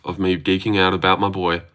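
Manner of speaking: normal talking voice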